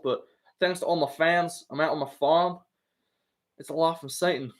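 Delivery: lightly voiced hillbilly accent; Bryce Mitchell hillbilly voice. Streamer quoting Bryce Mitchell's speech, light hillbilly accent. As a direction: quote him with a hint of backwoods twang. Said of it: "like Bryce Mitchell".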